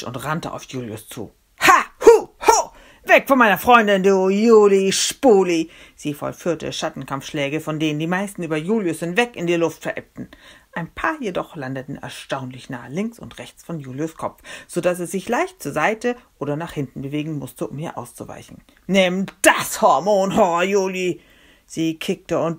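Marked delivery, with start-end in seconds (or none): 1.7-5.7 high, loud martial-arts yells; fierce, hammed-up fighting shout; battle cries. Friend attacks Julius with karate cries. 18.9-21.2 loud, higher attacking cry; combative, hammed-up yell; fighting shout. Shadow-boxing attack on Julius.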